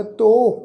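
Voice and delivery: ਤੋ with the o held long; lengthened vowel. Shown as a demonstration. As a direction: stretch out the o in ਤੋ. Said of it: "ਉਹ ਲਮਕਾ ਵਾਸਤੇ ਲੱਗਾ ਹੋਇਆ".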